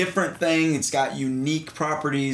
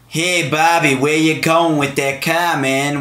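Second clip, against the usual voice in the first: mocking the film's thick Boston accent; mock heavy Boston accent; 3 semitones higher, 8 dB louder than usual. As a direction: parody the overdone movie accent. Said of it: "But they were so heavy".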